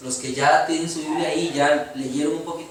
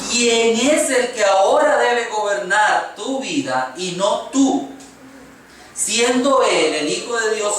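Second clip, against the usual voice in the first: slow, raised, drawn-out proclamation; preaching declamation; 4 semitones higher, 3 dB louder, slower than usual. Acted. Preacher rereading the verse in full for the congregation, emphatic declamation. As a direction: declaim each phrase slowly, pitched up.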